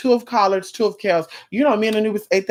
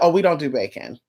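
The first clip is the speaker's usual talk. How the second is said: ordinary talk, nothing marked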